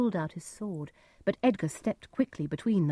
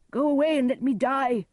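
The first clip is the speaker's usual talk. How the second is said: loud, high cry of despair; despairing outburst; 8 semitones higher, 5 dB louder than usual. A narrator's reading. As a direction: push the rescuer away with a raised voice. Despairing Gloucester rejects his rescuer.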